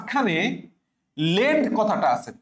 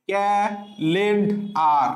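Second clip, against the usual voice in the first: Calendar said very slowly, syllable by syllable; teacher models calendar slowly; slow pronunciation; much slower than usual. Shown as a demonstration. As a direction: drag out calendar for students. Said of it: "মনে রাখবা মাঝখানে কি আছে?".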